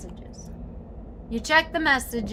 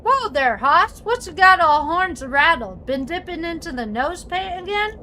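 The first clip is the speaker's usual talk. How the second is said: put-on cowboy accent; 6 semitones higher, 6 dB louder than usual. Character greeting Hoss, in a loud hammed-up cowboy accent. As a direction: hoot it like an old Western sidekick. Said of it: "Gabby doesn't talk like that".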